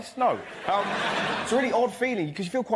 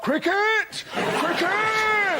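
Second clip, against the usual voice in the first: high, drawn-out comic shout; Telegraph's cry; 10 semitones higher, 3 dB louder, much slower than usual. Acted. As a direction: bellow one word up high as the newspaper. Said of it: "Tell 'em, Telegraph".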